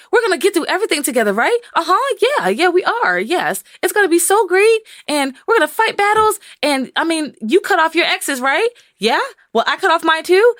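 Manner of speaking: mock starry-eyed eagerness; giddy lovers. Couple high on lust making promises, giddy.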